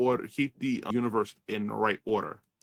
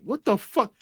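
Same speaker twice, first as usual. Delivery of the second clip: much higher, louder startled what the fuck; baffled; 10 semitones higher, 5 dB louder than usual. Retold or imitated. Watching the weird scene, high startled exclamation. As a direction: react in shocked disbelief. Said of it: "you're looking like".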